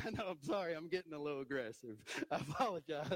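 sheepish apology through laughter; laughing apology. Real, not acted. Preacher laughing to his congregation after a fierce illustration, sheepish and giggly.

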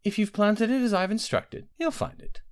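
high, breathy conspiratorial queen; scheming queen. Acted. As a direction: plot it in a hushed voice. Queen plotting in secret.

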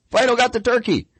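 high-pitched mock alarm; hammed-up high complaint; mock caller outcry. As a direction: cry it out high, like an upset caller. Caller wailing about stolen turkey.

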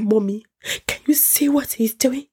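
high, rising tattling wail; whiny high complaint. Retold or imitated. Wife tattling to her mother about the husband, high whine. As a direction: tattle to mommy, outraged.

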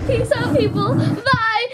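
high squeaky sign-off; high panicked. Pretend. Signs off in panic.

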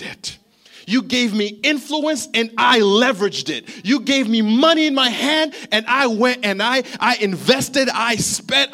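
loud, slow, pleading preacher cry; passionate prayer to God. Retold or imitated. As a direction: declare it to God with full force.

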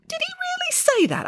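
very high, quick question; incredulous child. Acted. Michael interrupts the story in disbelief, incredulous.

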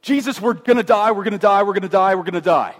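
frantic mock cry of terror; panicked disciples. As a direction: act out sailors sure they'll drown. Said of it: "they go".

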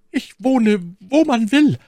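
very high, loud maid's voice; eifrige Ketty. Acted. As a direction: agree eagerly, high and loud. Maid agrees eagerly in dialogue. (eifrige, hohe und laute Mädchenstimme)

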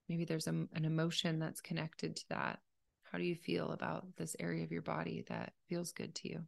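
calm, unhurried meditation leader; guided meditation. Acted. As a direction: gently guide a listener through breathing, leaving space between phrases.

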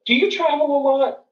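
high-pitched question in a student's voice; imitated college girl. College girl questioning a seasoned traveller, high imitated voice. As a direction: pitch it well up, like a young student. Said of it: "college girl, comes up to me".

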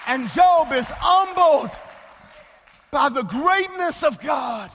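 slow, loud pulpit build; swelling sermon proclamation; preaching. Acted. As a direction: preach it out with full force. Preacher building to full force.

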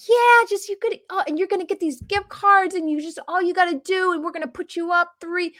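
high, bubbly sales pitch voice; salesperson pitch. Retold or imitated. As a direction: gush like a pushy timeshare seller. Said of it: "And they're like".

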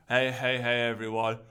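tired, dragging host intro, low and drowsy; low energy. Shown as a demonstration. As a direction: sound half asleep and flat. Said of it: "don't be doing that with a low energy".

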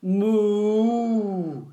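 long drawn-out moo; cow moo. A narrator's reading. Cow answers Duck's hello, mooing long. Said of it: "said Cow".

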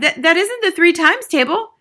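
high, loud stammered protest; flustered schoolboy; crying out. A narrator's reading. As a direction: stumble into an objection. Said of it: "Wilford cried".

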